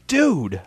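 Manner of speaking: DUDE high, then dropping; exclaimed tone. Shown as a demonstration. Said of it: "one word with a different tone of voice".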